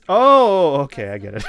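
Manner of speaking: usual speaking voice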